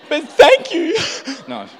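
real tears catching the thanks; choked up. Real, not acted. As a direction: voice breaking high with emotion, then a teary laugh. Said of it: "I'm getting emotional".

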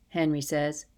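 ordinary delivery

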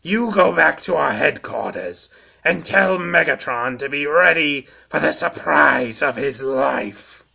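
scheming insect villain voice; sly, gloating villain; Bombshell voice. Acted. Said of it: "he added wickedly".

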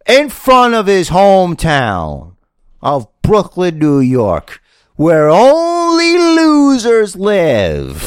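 high, loud, slow heel promo; wrestling promo. Pretend. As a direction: roar it like a cocky heel. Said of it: "do it like a wrestling promo".

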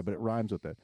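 usual speaking voice

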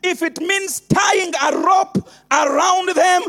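full-force preaching. Acted. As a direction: hammer each line home. Building sermon refrain, shouting.

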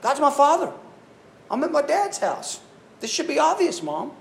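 put-on a kid stating the obvious to his mom; matter-of-fact boy. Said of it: "He's just like".

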